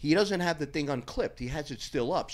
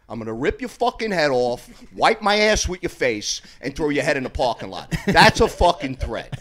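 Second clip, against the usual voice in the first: retold threat; 8 dB louder than usual. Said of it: "I said".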